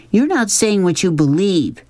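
put-on high-pitched girl's accusation; accusing. A narrator's reading. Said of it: "she accused".

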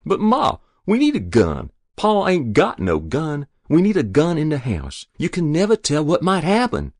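put-on high, insistent boyish appeal; pleading argument. A narrator's reading. Boy persuading Ma the house needs protection, pleading. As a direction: reason with her urgently.

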